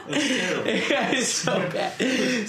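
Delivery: talking through high-pitched laughter; laughing. Real, not acted. Admitting to a friend the accent was awful, laughing. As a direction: laugh helplessly through the words.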